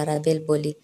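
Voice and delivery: ordinary delivery, nothing marked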